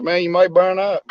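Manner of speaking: usual speaking voice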